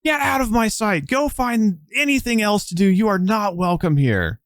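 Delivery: high, loud, shrill-edged shouting at her rival; furious scream. Acted. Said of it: "just, like, screams".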